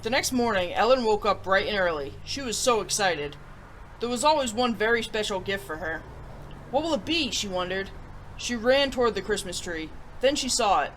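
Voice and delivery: gentle storybook reading; storybook narrator voice; story narration. Acted. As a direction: read it like a bedtime story to kids. Story read aloud to viewers.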